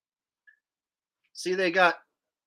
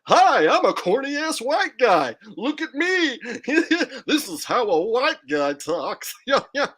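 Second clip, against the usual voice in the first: Dave Chappelle; 6 semitones higher, 4 dB louder than usual. Retold or imitated. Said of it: "when Dave Chappelle is like".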